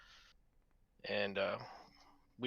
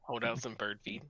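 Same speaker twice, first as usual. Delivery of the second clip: normal talking voice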